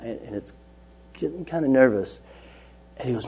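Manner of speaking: usual speaking voice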